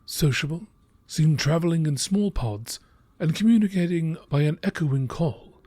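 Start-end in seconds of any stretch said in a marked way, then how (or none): none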